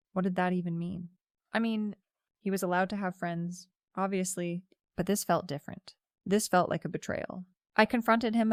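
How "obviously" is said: usual speaking voice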